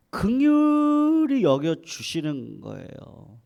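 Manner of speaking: 긍휼히 stretched long, high and loud; emphatic drawn-out stress. Real, not acted. Preacher proclaims God's mercy on Job, 긍휼히 drawn out loud. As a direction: hold the word 긍휼히 at full voice, then ease down.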